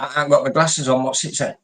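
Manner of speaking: ordinary talk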